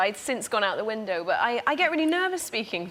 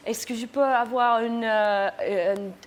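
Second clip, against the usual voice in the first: put-on foreign accent in French. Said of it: "And so I went, um".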